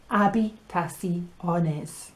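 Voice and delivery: "habitaciones" starting on the A sound; silent H. Shown as a demonstration. Teacher explaining the silent letter, "habitaciones" without the H. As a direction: drop the H from "habitaciones". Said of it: "and that is silent".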